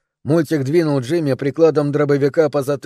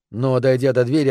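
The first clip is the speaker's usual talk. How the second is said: ordinary delivery, nothing marked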